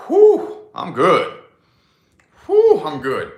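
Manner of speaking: loud, high, contented exhale; mock-satisfied puff after eating; satisfied whew. Full after one pizza slice.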